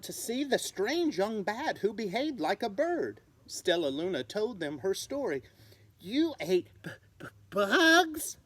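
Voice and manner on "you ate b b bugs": stammering, shocked gasp. Acted. Bat gasping at a bird-raised bat, stammering. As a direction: stutter out the horror, high and loud. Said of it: "gasped another".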